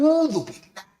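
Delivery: high, loud, shocked cry; horrified refuge. Acted. Young man seeking refuge in God, horrified. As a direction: cry out in horror.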